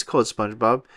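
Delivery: plain reading voice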